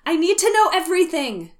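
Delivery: loud high desperate squeal; shrieking jackpot winner. Acted. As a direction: beg loudly like a frantic fan. Fan tweet desperate.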